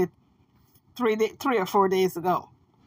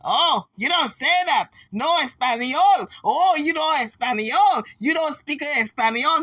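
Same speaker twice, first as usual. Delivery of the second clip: swooping singsong Spanish scolding; mock Spanish objectors; 4 semitones higher than usual. Spanish folks coming after the host, mock scolding. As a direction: protest in exaggerated mock-Spanish waves. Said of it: "here comes the Spanish folks after me now".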